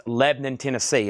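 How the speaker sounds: "Lebanon" squashed to LEB-n'n; "Lebanon" with middle vowel dropped, LEB-nun; local clipped pronunciation. Shown as a demonstration. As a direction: say "Lebanon" as nearly one syllable. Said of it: "It's one syllable".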